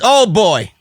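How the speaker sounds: normal talking voice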